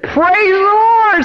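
high, long-drawn cry of praise; joyful exclamation. Retold or imitated. Wife hears they will give the car away, joyful exclamation. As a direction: imitate her delight, stretching the words right out. Wife's joyful response retold.

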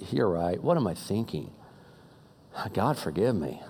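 low, soft admission of fault; hushed plea for forgiveness, acted; chastened repentance. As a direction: drop low and quiet, humbled.

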